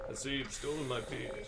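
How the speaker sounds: a hint of a grand god; lightly voiced heroic declaration; Thor. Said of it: "Specifically as Thor".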